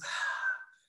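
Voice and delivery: voiceless exhaled "ah"; breath of life. Shown as a demonstration. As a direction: breathe out a soft puff of air. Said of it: "me suena al sonido de un soplo".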